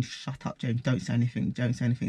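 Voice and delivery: hushed, low thought to himself; under the breath, urgently; urgent inner thought. Retold or imitated. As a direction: mutter it low, as if only thinking it. Urgent inner thought, telling James to keep quiet.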